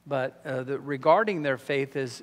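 usual speaking voice

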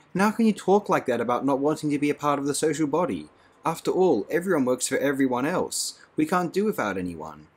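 Lenina, tearful, defends the social body; upset Lenina. A narrator's reading. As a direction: argue through her distress.

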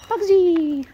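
high, loud sing-song call; calling the bird. Real, not acted.

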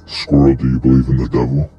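normal talking voice

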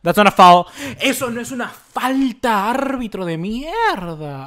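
loud protest at the referee; furious complaint at referee; angry protest. Real, not acted. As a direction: rage at a bad call. Arguing foul call at referee.

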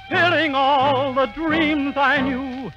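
ordinary delivery

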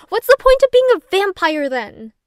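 loud, high-pitched exasperation; outraged fan, loud and high; indignant outburst. Acted. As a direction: burst out in disbelief, pitch shooting up. Outraged that vampire doesn't sparkle.

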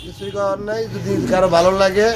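normal talking voice

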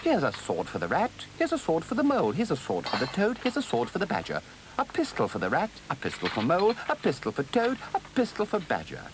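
high, rhythmic busy counting-out; excited muttering. A narrator's reading. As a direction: chant it excitedly to yourself while dashing about. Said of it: "saying excitedly under his breath as he ran".

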